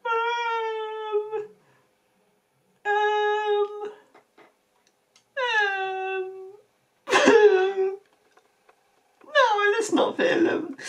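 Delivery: high, laugh-broken refusal; laughing through words. Real, not acted. To the cartoon characters, high laughing refusal. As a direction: refuse while still giggling.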